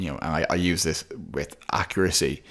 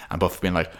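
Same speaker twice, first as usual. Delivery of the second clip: usual speaking voice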